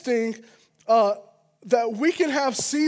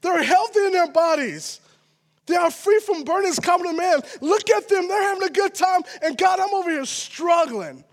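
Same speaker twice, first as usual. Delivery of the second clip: complaint building higher to a shout; rising complaint; 6 semitones higher than usual. Acted. David complaining to God, building to a shout. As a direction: pour it out, climbing to a cry. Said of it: "It looks like".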